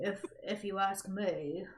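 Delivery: lightly voiced British accent. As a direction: try the title in a British accent. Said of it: "I'm trying to be British".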